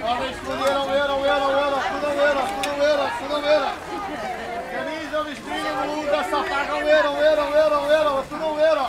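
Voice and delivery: loud high hawker patter; haranguing the crowd. Real, not acted. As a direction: call out bargains over the market noise. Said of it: "les camelots haranguent la foule".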